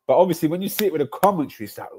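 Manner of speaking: normal talking voice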